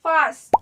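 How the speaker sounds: Fast in one loud burst; one-syllable demo. Shown as a demonstration. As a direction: say fast loudly as a single beat. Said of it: "एक बार".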